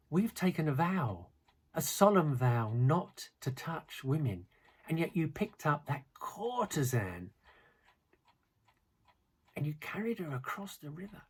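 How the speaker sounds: put-on a young monk scolding, voice climbing high; furious. A narrator's reading. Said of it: "the younger monk is furious".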